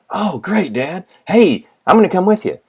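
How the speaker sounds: put-on high, eager kid voice as a seventh grader; a boy thrilled about buying a car; excited young son. Seventh-grade son to his father, high and eager. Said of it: "my father said".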